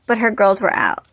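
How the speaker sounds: normal talking voice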